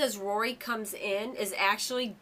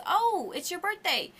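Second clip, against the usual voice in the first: higher, slowed, lightly voiced cheerful clerk; cheery shop clerk; 9 semitones higher, 3 dB louder than usual. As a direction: play the clerk, drawn-out and bright.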